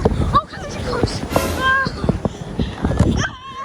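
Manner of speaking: high, loud startled yell; alarmed shriek. Real, not acted. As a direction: shriek as the sled closes in. Sled coming straight at her.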